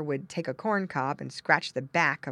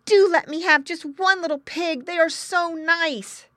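put-on high, loud child's begging; pleading boy; 13 semitones higher, 8 dB louder than usual. Boy begging father for a pig, pleading. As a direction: plead like an eager small boy.

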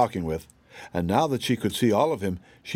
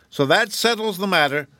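high, curt closing of the matter; dismissive rabbit; 8 semitones higher, 3 dB louder than usual. A narrator's reading. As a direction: close the case, self-important.

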